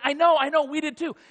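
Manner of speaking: high, loud, eager agreement; bubbling over with the news; excited insistence. Acted. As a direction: rush to reassure, thrilled.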